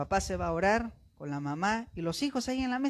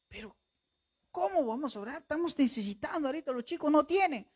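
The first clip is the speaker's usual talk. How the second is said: anxious protest; 6 semitones higher, faster than usual. Retold or imitated. Father protesting to wife.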